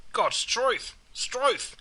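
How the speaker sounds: high-pitched exclamation of disbelief; exclaimed oath. Shown as a demonstration. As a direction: cry it out as an astonished oath. Demonstrates the oath and its short form.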